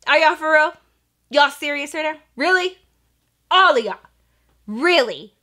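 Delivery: high, punchy questions with pauses between; acting out a dumbfounded coach; imitated incredulous coach. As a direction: let each question land in disbelief. Coach's disbelief at diet, retold.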